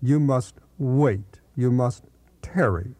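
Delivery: slow, weighty emphasis on "wait" and "tarry"; stress on wait. Retold or imitated. Preacher quoting those who stress waiting, "wait" and "tarry" slowly emphasized. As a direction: set "wait" and "tarry" apart, each drawn out after a small pause. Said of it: "I have heard some emphasize the word wait".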